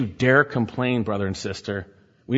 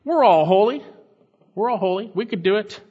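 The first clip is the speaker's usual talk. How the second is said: higher, louder, smug mock of the rebels; 8 semitones higher, 4 dB louder than usual. Preacher mocking Korah's rebels as smug upstarts, higher. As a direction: play the cocky rebels, higher and pushy. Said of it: "They're saying".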